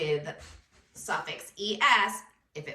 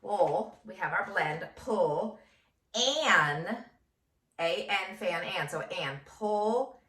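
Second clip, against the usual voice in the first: usual speaking voice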